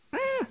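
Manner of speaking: sharp high yelp, soft; mock kid's cry. A kid getting swatted lets out a high yelp. Child swatted with paint stick.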